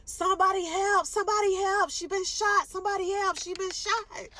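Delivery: high, loud frantic yell for help; cousin screaming for help. Retold or imitated. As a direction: shout it like a panicked cousin carrying you in. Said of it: "carried me inside ... hospital screaming".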